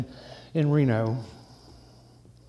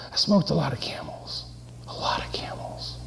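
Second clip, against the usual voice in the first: fading into a whisper; hushed confession; 2 semitones higher, 7 dB quieter than usual. Acted. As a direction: voice dropping away to bare breath. Confessing his heavy smoking, trailing off.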